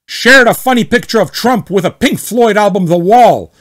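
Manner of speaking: hammed-up indignant poster; angry member listing his grievances; mock outrage. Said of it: "read as much as possible like him".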